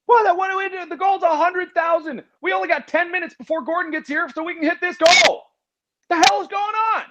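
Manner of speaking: cartoonish panic about the donation goal; mock panic. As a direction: fret in a high, hammed-up squeak.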